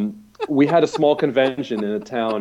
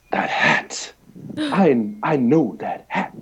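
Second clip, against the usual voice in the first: intense slow murmur; 3 semitones higher, much slower than usual. Retold or imitated. Avery Brooks eyes the fan's hat, intense and slow. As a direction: say it like you are seeing through him.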